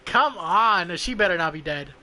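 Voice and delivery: ordinary talk, nothing marked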